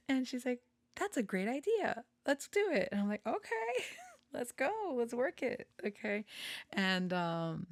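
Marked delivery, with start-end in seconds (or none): 1.0-2.9 high, eager retold reply; delighted agreement. Friend agreeing to the speaker's suggestion, mock-excited and high. 3.3-5.7 very high, playful retold okay; eager agreement. Speaker agreeing to work on the belt, high and giddy. Replays own eager reply.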